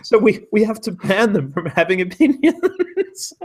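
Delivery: high-pitched, worked-up exasperation; mock outrage. Host parodies academic bosses banning junior opinions, mock outrage. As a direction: wind up into a high, indignant rant breaking into laughter. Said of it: "I'll get angry about this".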